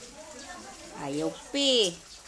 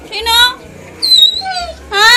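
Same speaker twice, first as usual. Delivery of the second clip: much higher, louder pleading to the dog; loud worried coaxing; 13 dB louder than usual. Real, not acted. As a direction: call out anxiously to the crying puppy. Owner pleads with upset dog. (malakas na nag-aalalang pagtatanong sa aso)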